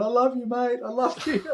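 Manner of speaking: high, tender and tearful-sounding; tender I love you. Retold or imitated. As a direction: pour out love to your son. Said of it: "all we're going is going".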